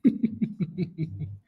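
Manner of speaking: high, breath-only giggle; long wheezy run of chuckles; real soft, high laughter; laughing. Real, not acted. Amused by chat.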